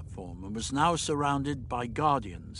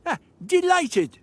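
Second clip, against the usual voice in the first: high, loud burst of delight; delighted agreement; 6 dB louder than usual. Acted. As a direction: accept with showy pleasure. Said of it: "the doctor agreed".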